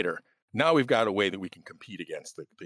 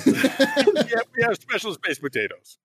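put-on laughing comic Russian accent, higher; mock Russian accent; 13 semitones higher, 5 dB louder than usual. Cohost joins the potato joke, laughing mock Russian accent. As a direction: play along in a hammed-up Russian voice.

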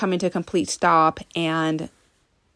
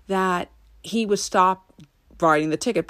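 normal talking voice

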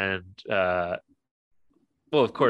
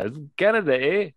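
high, loud mock Canadian "eh"; mock Canadian accent; 8 semitones higher, 4 dB louder than usual. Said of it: "a racist Mexican accent".

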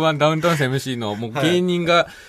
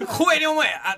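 hammed-up arrogant retort; jokingly shouting down a fellow comedian; mock shout; 12 semitones higher than usual. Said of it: "って".

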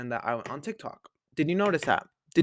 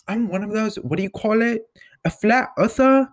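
imitating Millie; 8 semitones higher than usual. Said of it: "She was like".

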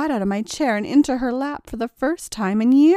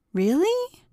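surprised; 9 semitones higher than usual. A narrator's reading. Surprised disbelief at offer.

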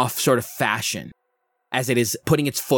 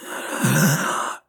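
gasp; 4 semitones higher than usual. Acted. Hag in euphoric hunger.

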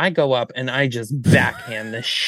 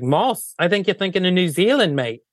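high, quick put-on Australian; mock Australian accent; 6 semitones higher than usual. Australian designers correct the moss mistake, mock Australian accent. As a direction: correct the visitor as a brisk fake Aussie. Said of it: "And they're like".

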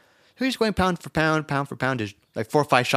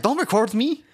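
Mike Tyson voice; 9 semitones higher than usual. Retold or imitated. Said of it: "just talking like that".

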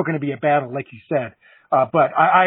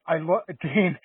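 ordinary talk

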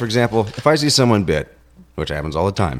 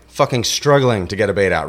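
usual speaking voice

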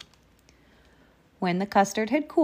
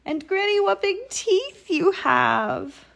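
very high, slow wonder; piping child's amazement; astonished little girl; 12 semitones higher, 4 dB louder than usual. A narrator's reading. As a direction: draw out TEETH in alarm and awe.

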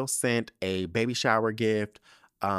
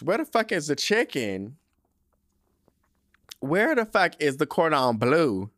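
indignant; 6 semitones higher, 4 dB louder than usual. Retold or imitated. Mock outrage over the lunch.

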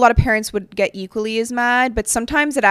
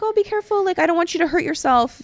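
put-on much higher, sweet voice for a child; nice and caring; 8 semitones higher than usual. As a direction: fuss kindly over the kid. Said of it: "if I'm just nice about it".

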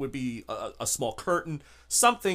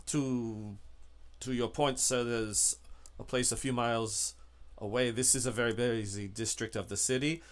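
low, soft, halting driver voice; obliging driver; 4 semitones lower, 7 dB quieter than usual. Acted. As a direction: deferential hired driver, quiet and low. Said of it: "Um, he says".

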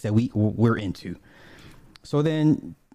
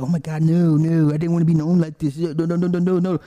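upset protest; 5 semitones higher, 4 dB louder than usual. Retold or imitated. Hillary upset over the footage.

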